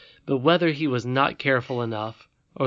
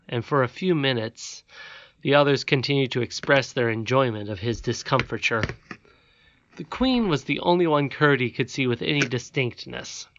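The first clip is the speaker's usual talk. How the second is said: ordinary delivery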